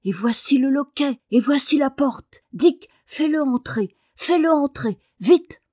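high, eager, mounting command; thrilled child hurrying a friend; urgent excitement. A narrator's reading. Daniel urges Dick in through the door.

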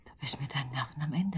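hushed whispered question; whispering back to his wife. Acted. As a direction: ask it in a bare whisper. Husband comforts wife at night.

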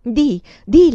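loud pressing "Di, di"; insistent urging. A narrator's reading. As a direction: press her to go on. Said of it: "la incoraggiava".

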